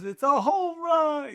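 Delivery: high, slow, drawn-out home run call; baseball announcer call. Retold or imitated. Recalling how baseball announcers call a home run, in a drawn-out high announcer call. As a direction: belt it like a ballpark announcer. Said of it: "baseball with announcers".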